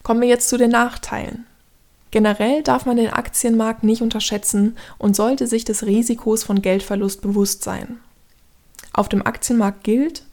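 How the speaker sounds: usual speaking voice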